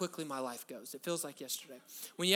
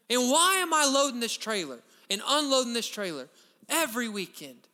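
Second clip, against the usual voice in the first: loud, high, rising preacher's cry; a pastor stirring his church to praise; preaching at full force; 4 semitones higher, 6 dB louder than usual. Real, not acted. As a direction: lift the room with it, full voice.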